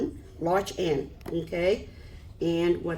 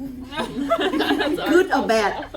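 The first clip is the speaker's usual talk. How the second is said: amused, slow and louder; laughing; 6 semitones higher, 5 dB louder, much slower than usual. Retold or imitated. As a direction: chuckle through it. Amused story of clapping class.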